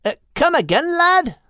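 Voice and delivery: high, puzzled Scottish bird; high bird voice, baffled; Crinan's voice. Acted. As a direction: ask it up high like a small bird. Crinan speaks to bearded dragon.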